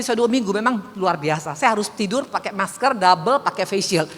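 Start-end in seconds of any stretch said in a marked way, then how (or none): none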